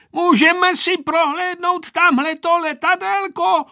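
put-on high, loud boy's question; calling into an ear. A narrator's reading. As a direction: pipe up loudly to be heard by grandpa. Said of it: "odvážil se zavolat Vojta do dědečkova ucha". (chlapecky volat dědovi do ucha)

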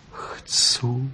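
breathless whispered answer; whispered yes. Acted. Vixen whispers her yes to the fox, unvoiced. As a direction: barely breathe the word. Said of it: "odvětila".

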